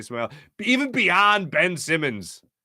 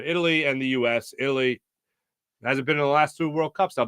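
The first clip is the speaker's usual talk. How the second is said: normal talking voice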